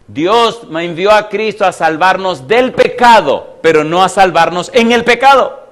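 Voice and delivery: loud, emphatic sermon delivery; impassioned preaching. Real, not acted. Preacher challenging the congregation about sin, impassioned. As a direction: drive each point home to the congregation.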